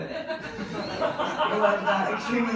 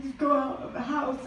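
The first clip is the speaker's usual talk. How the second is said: much higher, chirpy mock politeness; high fake cheer; 7 semitones higher than usual. Woman brushing off her body's bad news, high and chirpy. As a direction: squeak it out brightly, faking fine. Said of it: "You're like".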